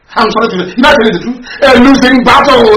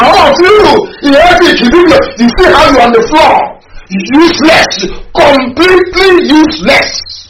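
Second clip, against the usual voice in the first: slow, breaking voice with sobs, higher pitched; crying lament; 5 semitones higher than usual. Real, not acted. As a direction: wail it out slowly, voice cracking with grief. Lament over Igbo on floor.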